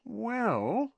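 high, drawn-out hesitation; slow. A narrator's reading. Parvati considers a partner for Ron, slowly. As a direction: stretch the word while thinking. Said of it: "said Parvati slowly".

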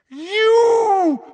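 very high, long screamed word; howl. A narrator's reading. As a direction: howl in horror at the sight of him. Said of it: "she howled".

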